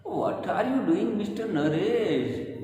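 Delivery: high rising on you, slow; a third tone variant. Shown as a demonstration. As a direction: lift "you" and stretch "Naresh". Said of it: "These are the tone and intonation".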